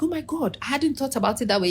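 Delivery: imitating surprised friend. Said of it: "she's like".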